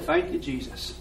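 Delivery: whispered thanks. Retold or imitated. Quiet thanks despite burnt hands.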